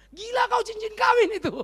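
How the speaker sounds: loud, high-pitched mock outburst; outraged spouse. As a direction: play an appalled spouse shouting in a quarrel. Acted married couple quarrel over ring.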